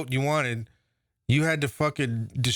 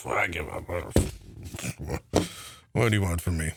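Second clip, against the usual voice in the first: mock flustered give-up; 10 semitones lower than usual. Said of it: "gets flustered and then goes".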